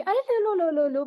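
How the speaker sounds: reluctant, insincere "लो, लो" with a high first "अरे"; half-hearted hostess pushing food; grudging offer. Shown as a demonstration. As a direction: offer the food while not meaning it. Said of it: "मैं गलत mood से कह रही हूँ".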